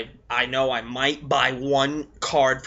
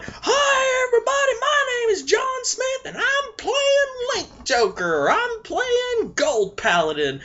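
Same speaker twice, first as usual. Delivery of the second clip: lampoon a player blurting out their deck, pitched way up; mock announcing yourself. Player announcing his deck to the room, squeaky mock voice. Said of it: "don't walk in there and going".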